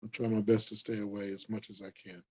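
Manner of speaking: normal talking voice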